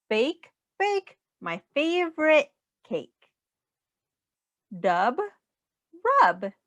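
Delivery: bouncy rhyming storybook voice, swinging up and down; sing-song read-aloud. Acted. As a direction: read it like a playful rhyme for a small child. Reading rhyming picture book aloud.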